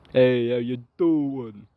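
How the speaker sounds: put-on slow, stretched Italian-accent greeting. Co-host greeting listeners in a put-on Italian accent. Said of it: "you trying to sound Italian".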